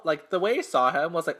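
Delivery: ordinary talk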